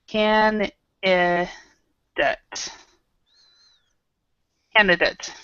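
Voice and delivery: Candidate said slowly as KAN-duh-dayt; slow model pronunciation. Shown as a demonstration. Teacher repeats Candidate slowly for the class. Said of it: "So it's more like this".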